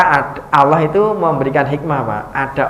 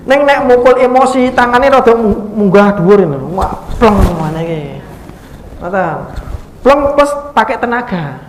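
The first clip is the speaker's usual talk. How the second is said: raised, heated telling of an angry slap; mock angry blow; 5 semitones higher, 3 dB louder than usual. Shown as a demonstration. Preacher shows an emotional teacher's slap. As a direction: act out hitting in anger, up high. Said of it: "Itu emosi".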